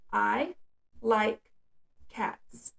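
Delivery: "I", "like", "cats" with a pause after each word and "cats" fading to a whisper; one word per popped bubble, pausing between words. Shown as a demonstration. Child popping a bubble for each word, pausing between words. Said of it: "they'll pop each bubble for each word".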